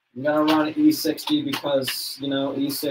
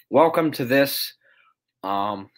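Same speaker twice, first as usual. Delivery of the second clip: ordinary delivery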